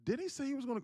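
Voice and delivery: high, fast mock imitation of his mother; quoting mom. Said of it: "I'm quoting her".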